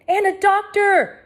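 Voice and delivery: slow high loud call; cried out. A narrator's reading. Hector crying out for a doctor. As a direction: demand it urgently. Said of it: "cried Hector".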